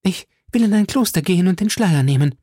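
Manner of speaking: high, hurried plea; desperate bargaining. A narrator's reading. Milady bargains for her life, high, fast and desperate. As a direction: rush out the offer in a high voice.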